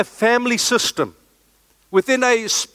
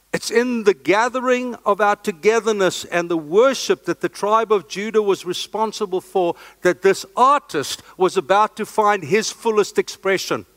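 normal talking voice

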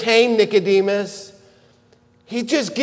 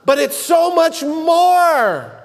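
emphatic rise; 7 semitones higher, slower than usual. Real, not acted. Pastor stressing salvation's scope to congregation, emphatic. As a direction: lift the point to the rafters. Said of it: "preacher stresses".

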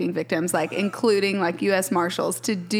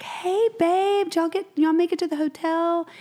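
cheery call; 10 semitones higher than usual. Retold or imitated. Wife retells calling husband.

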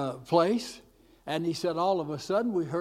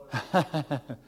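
real wordless chuckle; short chuckle. Real, not acted. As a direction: chuckle briefly between parts of the story. Preacher laughs after the quoted declaration.

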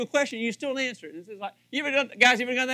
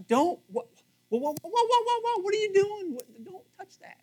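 high, rising whoas in mock alarm; 11 semitones higher than usual. Pastor acting alarmed spouse to congregation, high mock alarm. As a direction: play the startled spouse, way up high.

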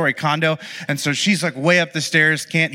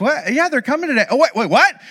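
surprised wife; 8 semitones higher than usual. Retold or imitated. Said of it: "And she's like".

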